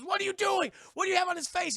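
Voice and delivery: put-on scolding the kid over the deer's sunglasses; mock angry yell. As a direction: confront him, outraged. Tulip confronts the kid.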